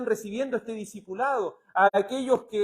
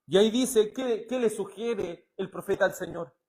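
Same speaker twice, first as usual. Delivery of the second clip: ordinary delivery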